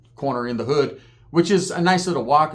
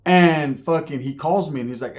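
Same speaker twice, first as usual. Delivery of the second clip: ordinary talk, nothing marked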